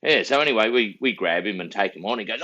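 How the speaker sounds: ordinary delivery, nothing marked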